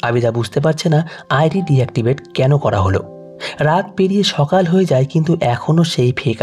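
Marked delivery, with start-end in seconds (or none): none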